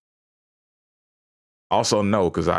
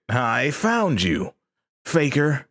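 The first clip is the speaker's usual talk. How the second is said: gloating; 8 semitones higher, slower than usual. Pretend. Gloating at found clip.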